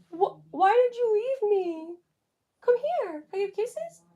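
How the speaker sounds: high pet voice. Real, not acted. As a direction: coo up high to the returning dog. Greeting the dog, pet voice.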